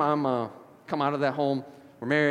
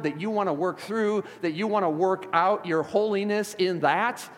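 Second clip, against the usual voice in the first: earnest spoken prayer; 5 semitones higher, 5 dB louder than usual. Acted. Leads prayer aloud to congregation.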